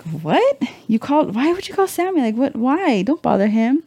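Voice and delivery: mock dismay. Mom questioning daughter's call to dad, mock dismay. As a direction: question it in high, incredulous protest.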